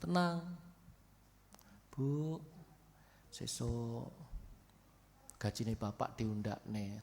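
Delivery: a soft, gentle husband calming his wife; sweet soothing voice. Pretend. Husband softly reassuring his wife about a pay raise, gentle and sweet. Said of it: "Suaranya merdu gitu".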